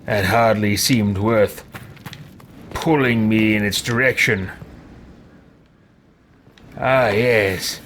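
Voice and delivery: low, slow, disdainful musing; haughty character. Acted. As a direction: speak low, as if it were beneath you. Haughty character comments in play.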